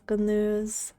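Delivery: usual speaking voice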